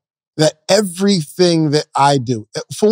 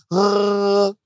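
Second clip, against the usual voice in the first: put-on baby's disgusted ugh; 5 semitones higher than usual. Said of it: "She's like".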